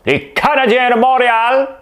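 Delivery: high, loud, hammed-up announcement; over-the-top ceremonial cry; mock proclamation. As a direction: call it out on one sustained high note. Mock proclamation after accent bit.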